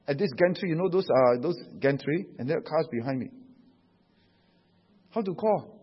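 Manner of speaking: usual speaking voice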